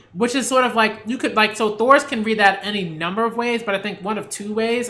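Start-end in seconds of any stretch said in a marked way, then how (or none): none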